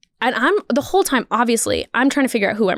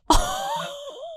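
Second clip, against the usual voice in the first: high delighted laugh; 6 dB quieter than usual. Real, not acted. Reacting to driver's line.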